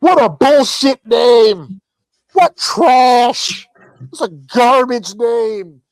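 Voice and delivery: loud, slow, jeering yell at a name; mock fan shout. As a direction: ham it up like an angry online troll yelling. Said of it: "they're like".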